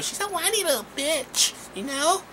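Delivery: put-on high, breathy whiny girl voice; mock the annoying girlfriend in a whiny squeak; mocking the girl's whiny voice from the movie; whiny girl impression. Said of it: "She talks like this".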